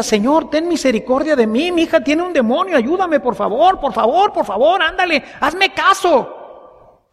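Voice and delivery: mock pleading cries, pitched high; high, urgent acted begging; pleading cry. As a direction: beg insistently, each plea tumbling after the last. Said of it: "La mujer grita y grita".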